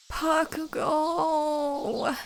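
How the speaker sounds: drawn-out high whine of Bakugo; whining. Acted. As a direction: stretch the name into a whine. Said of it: "you whined".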